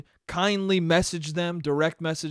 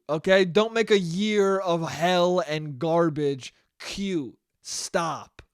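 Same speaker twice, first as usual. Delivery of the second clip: ordinary delivery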